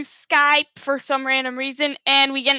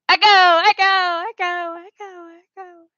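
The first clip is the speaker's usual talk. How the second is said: echo effect; 4 semitones higher than usual. Shown as a demonstration. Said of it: "the echo effects".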